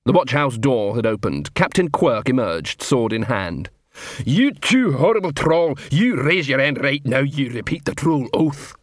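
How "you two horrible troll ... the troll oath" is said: troll drill sergeant, bossy. Acted. Sergeant orders two trolls to take the oath, bossy.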